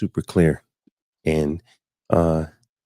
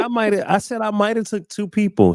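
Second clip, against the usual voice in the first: defensive; 14 semitones higher than usual. Retold or imitated. Defending himself against his fiancée.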